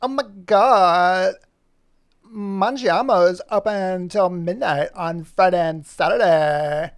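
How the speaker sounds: loud, slow, drawn-out excited character; Poppy voice. Acted. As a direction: gush it slowly like a thrilled pup. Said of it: "She'll go".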